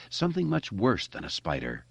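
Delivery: plain reading voice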